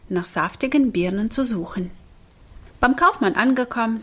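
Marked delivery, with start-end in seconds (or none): none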